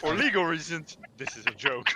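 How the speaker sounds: loud, high mock announcement; loud mock disclaimer. Real, not acted. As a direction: announce it like a legal warning.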